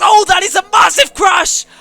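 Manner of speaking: loud, high shocked yell; shocked shout. Real, not acted. Sees massive crash, shouts.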